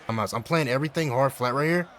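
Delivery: ordinary talk, nothing marked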